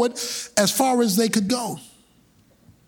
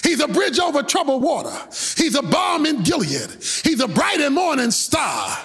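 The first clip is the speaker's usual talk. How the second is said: Moses as a preacher building to shouts; Moses preaching from the pulpit; 8 semitones higher than usual. Acted. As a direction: lift the crowd like a preacher on the pulpit. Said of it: "he's standing up and saying".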